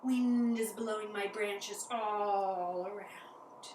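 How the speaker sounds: drawn out, slow; sway the words slowly like branches. Shown as a demonstration. Teacher being a tree in the wind, slow and drawn out.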